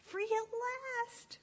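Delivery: high, jubilant little sheep; gleeful escape cry. Acted. Runaway sheep celebrates escape.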